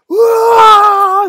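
very loud, high drawn-out "whoa"; battle cry whoop. Acted. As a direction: let out a kids' war cry at full volume. Boys' war cry while banging swords.

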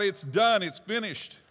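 victory shout. Shown as a demonstration. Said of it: "we shout out".

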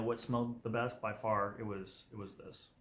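usual speaking voice

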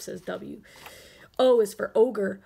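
ordinary delivery, nothing marked

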